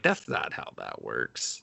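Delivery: low, soft, slow deadpan; mock deadpan. Mocking the friends' reply, low and quiet. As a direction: drop it flat and low like a tired doctor.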